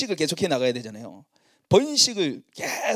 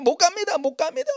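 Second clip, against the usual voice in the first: higher, louder mock protest of a flock member; 12 semitones higher, 3 dB louder than usual. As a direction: play the member who refuses, loudly. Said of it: "맨날 그러는 거예요". (밥 얻어먹기 미안해 거절하는 목장 식구, 높고 큰 목소리로 항의하듯)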